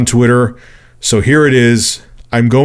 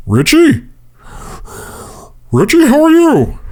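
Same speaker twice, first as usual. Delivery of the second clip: creepy stalker caller. Retold or imitated. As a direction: imitate a creepy caller, pitched way up. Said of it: "sounded exactly like Gary Oldman's character".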